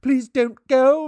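loud, high, slow plea; pleading cry. A narrator's reading. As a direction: beg her to stay. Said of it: "he cried".

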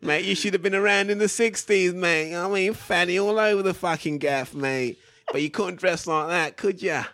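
lightly voiced cockney gym geezer; a hint of an old East End trainer; Terry. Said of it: "That wasn't Terry".

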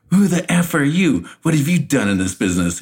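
hammed-up locker room fury; imitated hot outburst. Opponent snapping at Shark Boy in the locker room, mock anger. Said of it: "he gets hot with Shark Boy".

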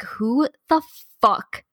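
slow, drawn-out annoyed question; exasperated driver. Retold or imitated. Woman stuck in traffic retells her annoyance, slow exasperation. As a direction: drag it out, fed up in traffic. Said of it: "and I'm like".

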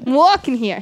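a hint of New York street accent; mock New York accent. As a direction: bark it like a New York cabbie. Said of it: "try to do an accent".

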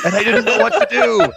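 can't hold back the laughter; laughing. Real, not acted. Laughing while retelling his partner's nightmare, words breaking up.

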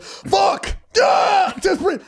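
high frantic yell, then shouting just breathe; stressed-out shout. Retold or imitated. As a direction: scream it like you're losing it. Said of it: "when they're stressed out".

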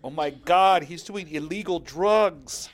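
shocked. Retold or imitated. Mock horror at drug ad.

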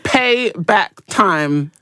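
payback time, drawn right out; very slow, deliberate pronouncement; quoted declaration. Retold or imitated. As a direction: spell it out slowly, word by word. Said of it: "they said".

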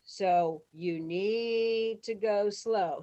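words stretched very slowly; drawn-out instruction. Retold or imitated. Director warning extras about the fast band, drawn out. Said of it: "And he goes".

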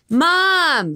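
high, drawn-out holler; calling for Mom. Acted. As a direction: yell across the house for your mother. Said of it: "screaming for whoever was coming".

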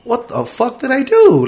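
high, drawn-out disbelief; acted-out baffled reaction to being yelled at; bewildered protest. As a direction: plead innocence in a high, stretched voice. Bewildered reply to shouting cop.

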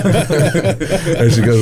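laughing through the words; can't hold back a laugh while telling it. Real, not acted. Telling friend about mom, laughing.

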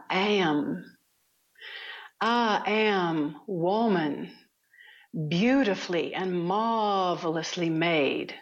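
put-on slow, drawn-out Southern Alabama accent. A nun opening yoga class in a slow Southern accent. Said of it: "she had a really heavy accent".

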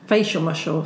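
usual speaking voice

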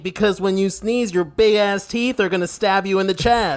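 mocking. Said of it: "They're like".

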